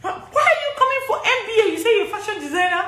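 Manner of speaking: quick, high, incredulous question; mock surprised immigration officer. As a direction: play the puzzled officer, half laughing. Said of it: "the immigration officer was surprised and said".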